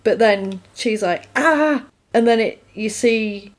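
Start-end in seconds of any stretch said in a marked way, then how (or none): 1.4-1.9 short, high cry; brief acted yelp, pitched up; mock scream. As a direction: imitate a sudden shriek of pain. She screams as she is stabbed.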